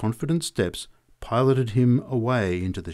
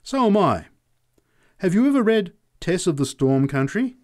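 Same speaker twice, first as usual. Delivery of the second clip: suddenly proud and eager, higher and louder; change of attitude; 9 semitones higher, 4 dB louder than usual. A narrator's reading. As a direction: perk up and boast a little, pitched high. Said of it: "with a change of attitude".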